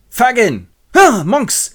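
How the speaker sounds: high, loud exclamations; startled hailing. Acted. Two crooks meet in the street, startled calls. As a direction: call out, then answer with surprise.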